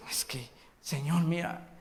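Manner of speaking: hushed secret prayer, almost no voice; sneaky whispered plea; whispered confession. Acted. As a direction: whisper it like a guilty secret to God. Sinner whispering a shady prayer.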